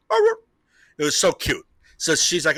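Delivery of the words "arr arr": short mock dog barks; imitated answering bark. Boy dog answering his sister down the hallway, imitated bark. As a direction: bark back like a little dog. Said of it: "and he's like".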